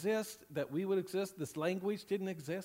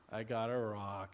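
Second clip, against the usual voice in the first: dejected Charlie Brown; 8 semitones lower, 5 dB quieter than usual. Retold or imitated. Pastor voicing Charlie Brown's disappointment, lower, mildly dejected. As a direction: lower and softer, a mild mopey sigh of a line. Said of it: "It's sad".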